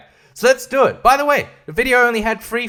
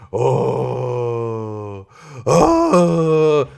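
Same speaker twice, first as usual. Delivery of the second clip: mock cry of despair; 7 semitones lower than usual. Real, not acted. Dismay at hate speech claim.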